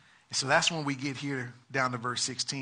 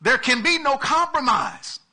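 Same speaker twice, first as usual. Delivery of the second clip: higher, louder preaching; full-force preaching; 9 semitones higher, 7 dB louder than usual. Real, not acted. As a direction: drive it home to the congregation.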